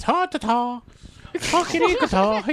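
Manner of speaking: high cartoon Irish gibberish; hammed-up, high Irish caricature. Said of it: "the stereotypical racist".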